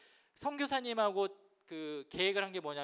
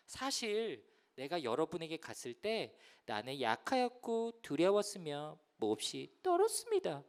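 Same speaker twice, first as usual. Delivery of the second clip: slow scripture reading; 3 semitones lower, slower than usual. Acted. Reads Paul's confession slowly.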